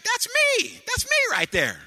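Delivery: put-on very high, loud little boy crying out; preacher imitating his grandson's delighted yell; excited toddler shout. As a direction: shout it like a thrilled three-year-old. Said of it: "he kept saying it".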